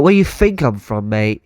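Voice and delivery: matey put-on Cockney; teasing mock-London accent; fake Cockney. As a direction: challenge them in a Cockney accent. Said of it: "Cockney one down".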